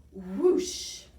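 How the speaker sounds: loud spoken whoosh. A narrator's reading. Narrator voicing the monster skidding away, loud whoosh. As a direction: make it rush past. Sound effect of sliding monster.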